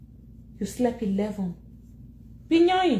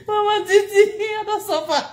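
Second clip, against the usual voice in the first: mock wail; 7 semitones higher than usual. Complaining to Mama Titi about suffering, mock crying wail.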